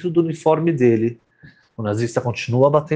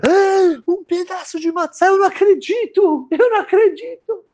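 overjoyed disbelief. Acted. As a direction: gasp with delight at the matzah. Tzadik sees the matzah.

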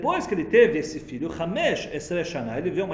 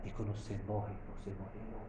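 soft and lower, almost swallowed; hushed; 8 semitones lower, 13 dB quieter than usual. Shown as a demonstration. Teacher contrasting life and death, hushed murmur. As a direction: let death drop to a murmur. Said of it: "lendo a vida e a morte igual".

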